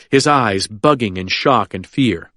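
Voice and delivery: ordinary delivery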